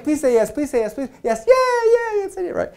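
high, breathless mock pleading for a yes; pleading eager hope. Product manager silently begging the tester, high mock pleading. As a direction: beg under your breath like an anxious product manager. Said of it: "you're secretly saying".

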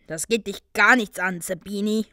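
loud, indignant snap; heated, forceful retort; angry snarl. A narrator's reading. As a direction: snap back at a taunt. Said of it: "fauchte Ron".

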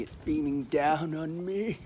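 drawn out, climbing into a high giggle; playful rising squeal. Pretend. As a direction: stretch it slowly upward till it breaks into squealed laughter. Playful reaction to sun coming out.

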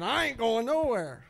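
high, loud defiant snarl; the demon digs its heels in. Retold or imitated. The demon refuses the preacher, loud and defiant. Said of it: "She started saying".

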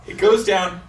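ordinary delivery

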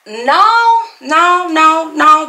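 high, rising run of no's, insisting; emphatic no. Real, not acted. Vlogger firmly rejecting a commenter's claim, emphatic.

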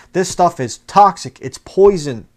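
real fired-up preacher's cry. Real, not acted. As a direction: hammer the warning home, slow and loud. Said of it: "I'm fired up".

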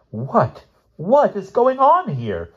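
high, slow startled woman's voice; stammering nurse. Acted. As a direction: play the flustered older nurse. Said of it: "the older nurse finally stammered".

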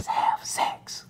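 breathy joking whisper of the punchline. Acted. Coach letting sales reps in on a joke, whispered. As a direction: drop to a secret whisper for the joke.

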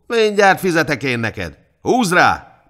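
high, loud threat into a shouted order; threaten, then bark the order to strike; angry command. A narrator's reading. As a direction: judge shouting in anger. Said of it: "parancsolta a hajdúnak".

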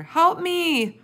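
high, loud cry for help. A narrator's reading. Sophie calls her parents for help, crying out. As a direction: cry out at the top of your voice. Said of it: "she cried".

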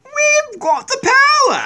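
high, slow, shouted villain boast; mock Illuminati voice. Mocking the Illuminati for the viewers, a high slow shouted boast. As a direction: ham up a gloating secret society. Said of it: "I don't know why they talk like that".

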